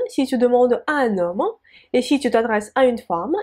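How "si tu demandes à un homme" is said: ordinary talk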